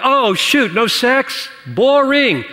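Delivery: mock disappointment. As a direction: act out a let-down, bored objection. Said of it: "So don't feel like".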